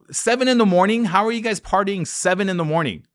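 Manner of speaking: can't believe what he's seeing; incredulous. Retold or imitated. Landlord shocked tenants party at dawn, incredulous and loud.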